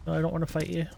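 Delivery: ordinary talk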